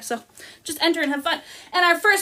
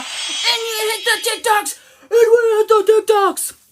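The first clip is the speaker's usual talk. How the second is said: high, drawn-out cheer; excited celebration; 8 semitones higher, 4 dB louder, slower than usual. Real, not acted. Host celebrates viewers' TikTok gifts, high and excited. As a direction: stretch it out in a high, overexcited voice.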